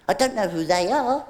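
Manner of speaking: ordinary delivery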